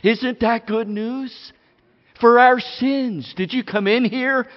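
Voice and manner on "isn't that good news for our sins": ordinary talk, nothing marked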